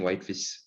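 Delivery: whitefish ending in a plain s; Cree whitefish. Shown as a demonstration. Teacher showing how Cree speakers say it, plain s ending. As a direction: drop the sh, say whitefis. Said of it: "We don't have that sh sound".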